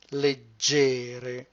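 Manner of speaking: Leg-GE-re with a long, stressed middle syllable; stress on second syllable. Shown as a demonstration. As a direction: stretch and stress the GE in "Leggere". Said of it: "On the second".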